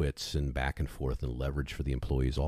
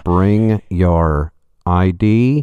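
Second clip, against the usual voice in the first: louder, slow bored clerk voice; DMV clerk; 12 dB louder, much slower than usual. Pretend. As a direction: drawl it like a tired clerk. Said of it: "the person from the D M V".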